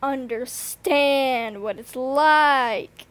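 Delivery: loud, slow, drawn-out exasperation; exasperated outburst. Retold or imitated. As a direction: lay it on thick: you don't get it. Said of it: "and I'm like".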